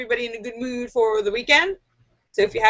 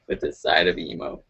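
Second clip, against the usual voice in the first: much lower, softer mopey emo mumble; gloomy emo; 6 dB quieter than usual. Retold or imitated. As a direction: sink into a bored emo drone. Mock emo order.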